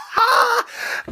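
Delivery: short shrill giggle fit; high squealing laugh. Real, not acted. Laughing at rivals' loss.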